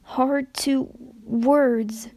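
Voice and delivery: slow, broken words, barely finding them; halting, confused mare. Acted. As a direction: push each word out slowly, lost. Confused pony struggles to speak.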